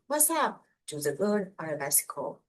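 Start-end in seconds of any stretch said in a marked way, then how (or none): 0.1-0.7 higher-pitched mock call-out; mock yell. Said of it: "he yells".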